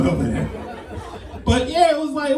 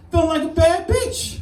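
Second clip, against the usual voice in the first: sassy diva voice; 10 semitones higher than usual. Pretend. Bragging about dating app attention.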